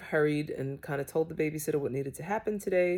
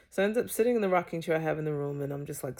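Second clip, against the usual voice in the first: normal talking voice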